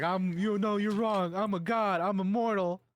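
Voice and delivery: high-pitched, tearful insistence; imitated crying protest. Beaten villain insisting he's a god, tearfully defiant. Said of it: "he's just crying".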